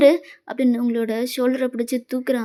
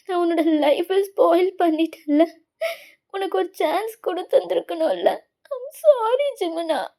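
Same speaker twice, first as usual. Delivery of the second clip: voice breaking sob. Acted. Sobbing as she apologises to Jimmun. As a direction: let the voice crack as she begs.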